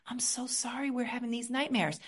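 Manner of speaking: soft, hushed and tender; tender whisper to self. Shown as a demonstration. Speaking softly to her scared inner child, a tender whisper. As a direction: whisper gently to your own heart. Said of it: "I reach for my own heart and I say".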